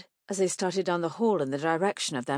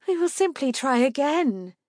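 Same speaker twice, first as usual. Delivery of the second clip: high, louder wifely insistence; insistent mother; 8 semitones higher, 5 dB louder than usual. A narrator's reading. Mother insists to annoyed husband.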